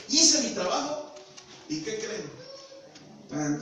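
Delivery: normal talking voice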